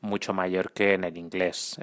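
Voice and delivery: ordinary delivery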